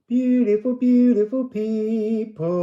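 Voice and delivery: high, loud sing-song greeting to beautiful people; channelled voice. Acted. Psychic channelling a message to viewers, high and loud. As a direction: call out like a spirit speaking through her.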